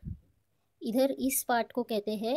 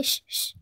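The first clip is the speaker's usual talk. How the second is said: whispered shh shh; quick unvoiced shushing. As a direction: hush her with a breathy shh. Big sister shushing little sister.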